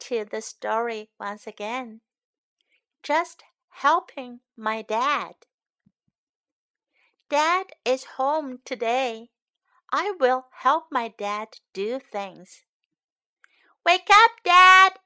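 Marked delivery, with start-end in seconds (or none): 3.0-12.6 put-on lively storybook read-aloud for kids; picture book read to young learners; reading to children. 13.8-15.0 put-on high, loud little-boy call; child shouting dad awake; eager child call. Child wakes sleeping dad.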